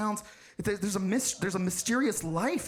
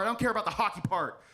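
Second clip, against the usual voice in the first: usual speaking voice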